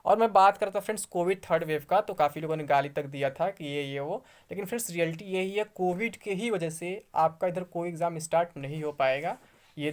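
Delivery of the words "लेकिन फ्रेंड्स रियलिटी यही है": ordinary talk, nothing marked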